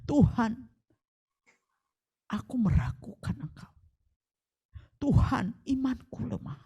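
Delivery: hushed, halting pleas to God; quiet intimate prayer; soft heartfelt prayer. Shown as a demonstration. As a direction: whisper your doubt to God. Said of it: "katakan itu dari dalam hatimu".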